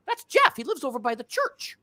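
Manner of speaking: very high, hammed-up exclamation; punchline in a high, excited voice; gleeful recognition. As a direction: imitate someone delightedly spotting an acquaintance. Husband recognising him, punchline acted.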